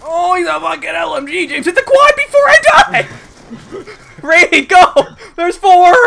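high, loud frantic shouting; urgent callouts. Real, not acted. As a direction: yell orders mid-fight.

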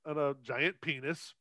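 ordinary talk, nothing marked

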